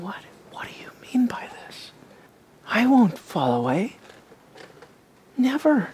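hushed unvoiced whisper; John whispering. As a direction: lean in and whisper the doubt. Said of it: "You can imagine John saying".

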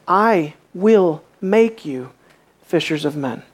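slow, weight on will in I will make you; authoritative will; stress on will. Shown as a demonstration. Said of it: "It is Christ's authority".

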